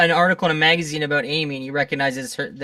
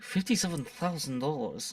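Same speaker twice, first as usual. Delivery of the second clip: much softer put-on villain voice; Tim Curry; 3 semitones lower, 11 dB quieter than usual. Co-host quoting the film's fuel price, hushed impression. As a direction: drop it low-key in a character accent. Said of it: "And Tim Curry's like".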